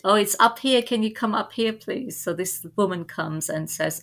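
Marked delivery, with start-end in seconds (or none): 0.0-2.2 retold shout, higher and louder; raised call for help from up a ladder. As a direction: call down to a stranger to come upstairs. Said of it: "and I shouted".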